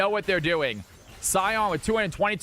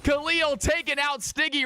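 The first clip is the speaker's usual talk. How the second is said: excited shout; 8 semitones higher than usual. Real, not acted. Caster hypes a kill.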